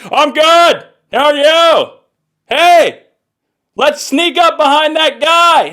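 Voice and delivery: high, loud shouting of someone gone deaf; can't control volume. Acted. Deafened Captain America answering his teammate, oblivious shouting. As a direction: blare cheerful small talk far too loud. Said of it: "can't control the volume of his voice".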